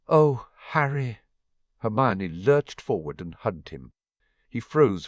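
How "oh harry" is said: emotional outburst. Acted. Hermione overwhelmed, lurches into hug.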